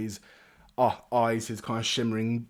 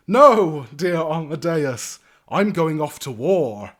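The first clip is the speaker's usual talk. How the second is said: loud, slow lordly declaration; grand young lord; 4 semitones higher, 10 dB louder, much slower than usual. Acted. Young lord tells his knight of war, grandly. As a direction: proclaim it with relish.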